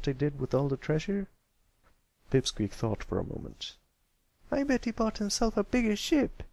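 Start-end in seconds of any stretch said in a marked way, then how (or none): 4.5-6.4 put-on young filly voice. Filly guesses in story.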